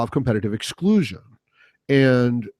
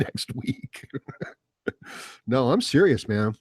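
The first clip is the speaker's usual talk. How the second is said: ordinary delivery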